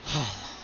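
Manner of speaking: low, soft sigh; deep breath. Acted. Mother asked about her daughter's marriage, soft deep sigh. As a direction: breathe out heavily before explaining. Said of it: "takes a deep breath".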